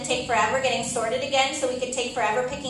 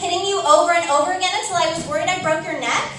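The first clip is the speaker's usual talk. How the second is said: angry; 6 semitones higher, 6 dB louder than usual. Acted. Fight escalates to violence.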